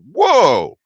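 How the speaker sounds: a loud, high gasp of wonder at the view; surprised whoa. Real, not acted. Vlogger looking down at tiny ants on the street, surprised. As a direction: react as the street drops away below you.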